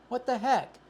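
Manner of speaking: loud, high disbelief; real shock at the fine print; surprised outburst. Real, not acted. Reading the hotel credit rules, a loud high outburst.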